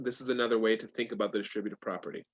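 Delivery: usual speaking voice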